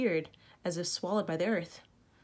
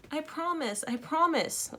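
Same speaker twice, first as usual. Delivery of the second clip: higher pleading cry; wailing; 9 semitones higher, slower than usual. A narrator's reading. Frightened girl promises to return the treasure, wailing. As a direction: beg to be rescued. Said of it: "wailed Denise".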